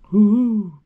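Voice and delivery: high, gleeful woo-hoo; whooping cheer. Retold or imitated. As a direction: whoop like a thrilled pilot. Pilot cheers flying into space.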